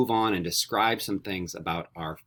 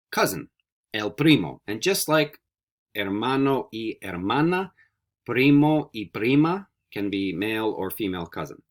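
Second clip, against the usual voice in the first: normal talking voice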